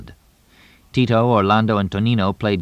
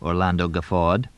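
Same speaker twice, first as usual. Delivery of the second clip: ordinary delivery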